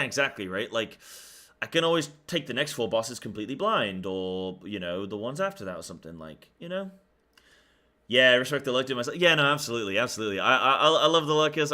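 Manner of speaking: ordinary delivery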